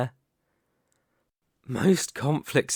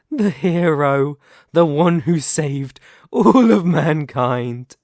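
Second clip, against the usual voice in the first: sneering mockery; 4 semitones higher, 8 dB louder than usual. Villain mocks the fallen hero.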